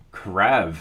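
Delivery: Krav ending in a V sound; V-sound pronunciation. Shown as a demonstration. Streamer corrects himself on a Danish name, V-sound pronunciation. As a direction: say "Krav" with a final V, not G. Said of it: "more of a V sound".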